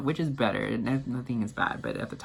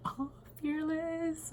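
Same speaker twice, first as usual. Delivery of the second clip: imitating a high, soft, drawn-out aww; touched cooing; 7 dB quieter, much slower than usual. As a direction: coo it slowly and very high, touched. Imitates singer touched by tattoo.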